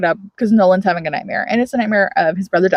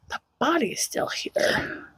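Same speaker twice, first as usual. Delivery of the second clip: slow whispered disbelief; woman finding the body, whispered and slow; hushed whisper; 4 semitones higher, 12 dB quieter, slower than usual. Retold or imitated. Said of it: "she's like".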